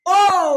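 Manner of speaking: high, loud, sustained "ઓ"; sound demonstration. Shown as a demonstration. Teacher models the vowel for pupils, high loud long "ઓ". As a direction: belt out "ઓ" as a long o. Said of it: "એનો ઉચ્ચાર આપણો શું થાય".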